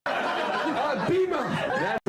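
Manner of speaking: giggling out the nickname; squeaky, laughing "Beamer". Real, not acted. Says nickname while laughing.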